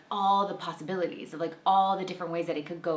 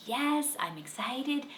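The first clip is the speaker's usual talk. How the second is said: higher, eager kid voice imitating the son; excited child; 6 semitones higher than usual. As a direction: rattle off a kid's wish list with excitement. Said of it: "he's like".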